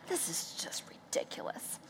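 whispered inner complaint; hushed exasperation; whispered grumble. Retold or imitated. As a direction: grumble under your breath.

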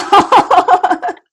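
bright high burst of laughter; delighted laugh. Real, not acted. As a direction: laugh out loud with pleasure. Delighted reaction to a compliment.

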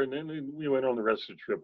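usual speaking voice

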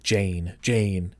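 low, soft repetition of a name; imitating puzzled murmuring to oneself; musing on a name. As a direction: try out the name quietly, as if it sounds odd. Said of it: "her name sounds really weird".

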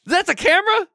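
high shocked question; surprised. Acted. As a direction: squeak it in disbelief. Shocked at hidden camera.

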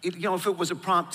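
ordinary talk

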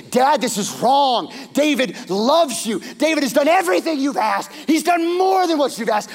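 high, loud pleading outburst; impassioned plea. Retold or imitated. Michal confronting her father Saul for David, impassioned and loud. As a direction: stand up to him and let it pour out.

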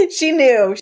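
high laughing reply that bursts into giggles. Real, not acted. Host laughs at how moms always know. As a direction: laugh it out, delighted.

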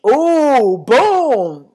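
loud, drawn-out ooh and boom; triumphant boom. Real, not acted. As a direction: land it big, swooping up then down. Mock impact exclamation after her point.

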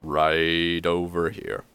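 slow, drawn-out servant voice; disheveled butler. Acted. Butler showing a guest the coat room, slow and deferential. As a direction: stretch it out like a tired butler. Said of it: "and he goes".

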